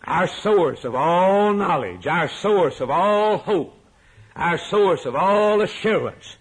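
high, loud, slow pulpit proclamation; full-force preaching. Real, not acted. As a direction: declaim it forcefully, stretching the key words.

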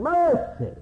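one word pitched very high; sudden high preacher's climax; echoed cry. Acted. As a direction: sing out the word as the beggar's high plea.

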